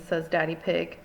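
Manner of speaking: usual speaking voice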